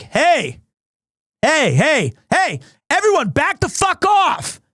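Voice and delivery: mock airport worker shouting orders; worker yelling. Worker yells at crowd piling onto bus, shouting. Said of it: "this one worker was like".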